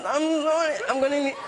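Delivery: high, fake-tearful pleading through laughter; mock sobbing plea. Actor fakes tears to flee an audition, high mock sob. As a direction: hams up crying to escape the audition. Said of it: "I made myself cry and I went".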